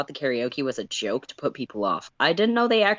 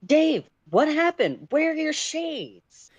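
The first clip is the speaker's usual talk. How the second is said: high, startled question, slowing on shades; surprised; 12 semitones higher than usual. Acted. John seeing Dave without his shades, surprised. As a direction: gasp it out, eyes going wide. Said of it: "John asks in surprise".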